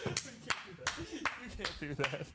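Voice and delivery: very soft, slow, almost whispered; hushed self-talk. Retold or imitated. Man staring too long at friend's baby, hushed warning. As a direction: murmur it under your breath.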